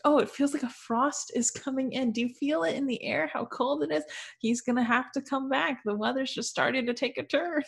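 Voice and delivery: high-pitched airy mock optimism; cheery, happy. Marianne sensing frost while out walking, mock cheery. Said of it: "she's all cheery and, like, happy".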